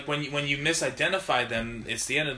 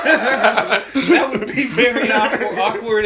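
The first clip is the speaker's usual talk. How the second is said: loud, slow laughing words; 6 semitones higher, 7 dB louder, much slower than usual. Real, not acted. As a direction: laugh through the line.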